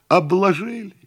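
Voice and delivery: high-pitched exclamation; shout. A narrator's reading. Cousin learns of the tax, crying out. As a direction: cry it out high in alarm. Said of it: "крикнула кузина".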